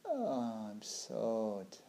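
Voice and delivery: drowsy, fading murmur; sleepy sigh. Retold or imitated. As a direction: let the tiredness drag each word. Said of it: "you're just like".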